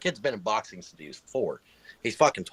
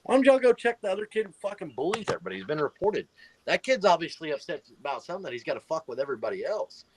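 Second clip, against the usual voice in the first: heated retort; 8 semitones higher than usual. Retold or imitated. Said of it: "I was like".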